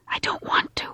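hushed, toneless whisper; murmured back. A narrator's reading. Husband murmuring back to his wife, reluctant whisper. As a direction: murmur it, barely loud enough to hear. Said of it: "murmured back, just loud enough".

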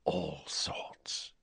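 very soft whisper; hushed, secretive whisper; breathed. A narrator's reading. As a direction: breathe it out, barely audible. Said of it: "breathed Riddle".

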